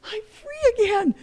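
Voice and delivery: put-on high, elated little-kid cry; imitating freed child. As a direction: play a child bursting with release. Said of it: "he lets it out, and he's like".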